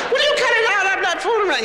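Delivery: high, loud scolding shout; imitated mother's loud high telling-off; mom yelling. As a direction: yell at the kids to stop, really high. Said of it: "So I said".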